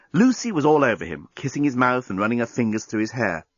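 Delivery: ordinary delivery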